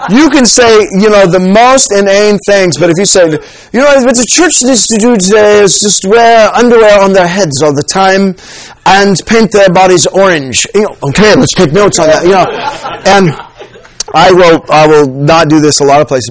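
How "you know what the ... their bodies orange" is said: put-on British accent. Shown as a demonstration. Preacher showing how smart nonsense sounds, mock British accent. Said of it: "talk with a British accent".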